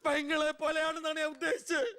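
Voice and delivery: high, panicky backpedalling; frantic excuse. Acted. Man correcting his slip to an offended madam, panicky. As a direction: plead innocence in a high squeal.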